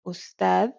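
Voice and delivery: "أستاذ" ending in a voiced th, as in "this"; "أستاذ" said ustadh. Shown as a demonstration. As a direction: end "أستاذ" with the tongue between the teeth. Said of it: "as a th".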